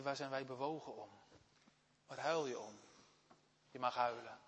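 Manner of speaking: ordinary talk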